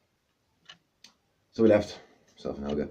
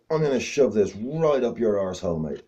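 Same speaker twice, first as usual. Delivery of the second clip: loud, higher menacing threat; mock threat; 7 semitones higher, 7 dB louder than usual. Man waving a red-hot poker at the dog, mock menace. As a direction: threaten him, half roaring.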